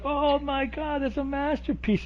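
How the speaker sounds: high, soft, drawn-out mock awe; gushing beatniks. Host voicing beatniks admiring a splattered painting, gushing. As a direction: imitate pretentious art fans swooning. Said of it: "All the beatniks are like".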